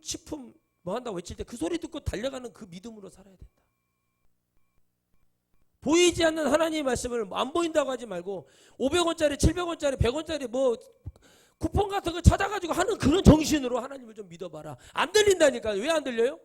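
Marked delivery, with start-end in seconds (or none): 5.8-16.4 loud, high, impassioned sermon push; full-force preaching. Acted. Preacher scolding his congregation, at full force. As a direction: drive the rebuke home to the congregation.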